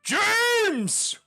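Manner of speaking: very high, loud, slow joyful bellow; huge hearty welcome shout; booming welcome. Acted. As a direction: greet him like a long-lost son. Aarakocra greets James with hug.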